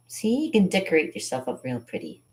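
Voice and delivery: ordinary talk, nothing marked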